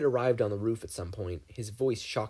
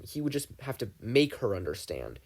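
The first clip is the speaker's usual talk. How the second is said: usual speaking voice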